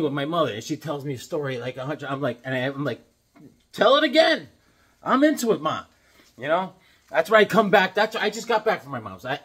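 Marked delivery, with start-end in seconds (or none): 3.7-5.8 mock eager son. Son humoring mother's repeated story.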